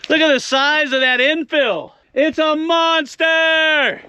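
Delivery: high-pitched awe, building to a long drawn-out exclamation; excited marvelling. Real, not acted. Rockhound showing viewers a monster infill, excited marvelling. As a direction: marvel at it, stretching the last words way out.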